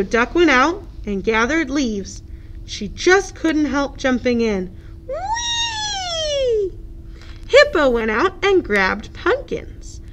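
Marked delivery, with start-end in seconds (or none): 5.1-6.7 very high drawn-out whee; gleeful whee. Acted. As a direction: squeal with flying joy. Duck jumping in leaves.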